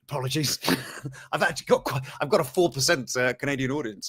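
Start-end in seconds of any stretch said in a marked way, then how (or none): none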